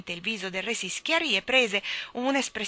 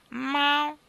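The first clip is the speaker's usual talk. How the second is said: high, loud mewing meow; sharp high cat cry; 8 semitones higher, 4 dB louder than usual. Acted. Cat meows.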